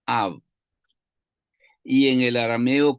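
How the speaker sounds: ordinary delivery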